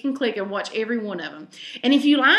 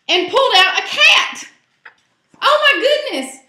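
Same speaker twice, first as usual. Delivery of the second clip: high, loud gasp of surprise; amazed reveal; 12 semitones higher, 8 dB louder than usual. A narrator's reading. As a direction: reveal it like a shock. Storyteller's big reveal.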